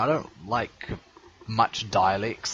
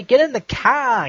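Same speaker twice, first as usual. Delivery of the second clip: high, loud Australian "Get in the car" with "car" as /kæ/; Australian accent; 11 semitones higher, 8 dB louder than usual. Shown as a demonstration. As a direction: sound like an Australian. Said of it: "Australians say".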